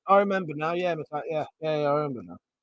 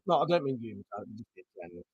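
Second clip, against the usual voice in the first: ordinary talk, nothing marked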